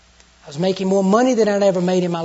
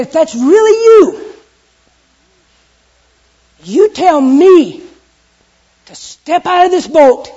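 slow, loud, high calling out; loud Peter challenge; 9 semitones higher, 11 dB louder, slower than usual. Acted. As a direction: shout the dare across the waves.

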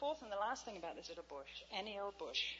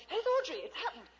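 excited announcement; 11 semitones higher, 13 dB louder than usual. Retold or imitated. Calling out joyfully to Jenny.

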